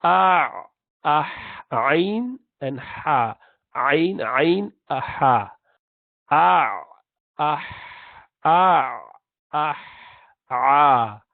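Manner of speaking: ain and ha breathed from the mid-throat; middle throat letters. Shown as a demonstration. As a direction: model the ain and ha sounds. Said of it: "you pronounce".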